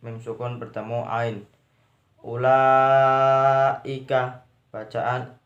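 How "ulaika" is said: "Ulaika" with a very long, loud, level laa; mad wajib muttasil. Shown as a demonstration. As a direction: hold "la" in "Ulaika" long before the ika. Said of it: "mad wajib mutasil". (baca "Ulaika" dengan la dipanjangkan sekali)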